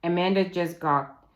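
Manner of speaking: ordinary delivery, nothing marked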